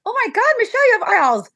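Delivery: high, thrilled gasp of surprise; amazed delight. Retold or imitated. Mother retelling her reaction to daughter's eyebrows, delighted surprise. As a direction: squeal the news of the eyebrows. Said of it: "I'm like".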